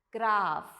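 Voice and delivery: "graph" as GRAAF, long vowel, ending in F; model pronunciation. Shown as a demonstration. Teacher showing how to read the word, firm model pronunciation. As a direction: say "graph" firmly, PH as F. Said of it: "You read this as".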